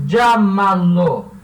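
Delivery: llámalo said slowly, stress on LLÁ; careful pronunciation. Shown as a demonstration. Teacher modeling llámalo for students, slow and loud. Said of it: "Então, vai ser".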